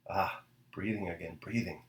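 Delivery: low, soft, private mutter; muttering to himself. A narrator's reading. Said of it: "he muttered to himself".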